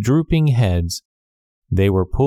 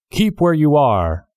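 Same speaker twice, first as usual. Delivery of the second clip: high guard's call, mildly raised; 6 semitones higher than usual. A narrator's reading. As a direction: order a stranger to halt from afar. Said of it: "the guard called to the voice".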